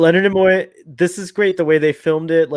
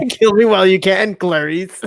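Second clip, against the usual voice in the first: higher, louder mock movie-quote voice; funny voice; 5 semitones higher, 4 dB louder than usual. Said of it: "I'll read it in a funny voice".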